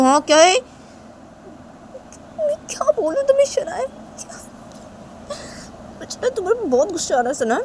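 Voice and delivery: worked-up scolding with a tearful edge; angry, upset Tisha. Acted. Tisha orders Shanaya to stop talking and sleep, angrily. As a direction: build from exasperation to a loud telling-off.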